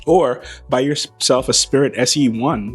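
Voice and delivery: ordinary talk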